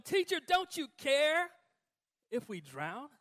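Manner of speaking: high, loud desperate plea; panicked cry. Acted. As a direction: cry out over the storm in panic. Disciples panic in the storm.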